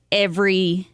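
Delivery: ordinary talk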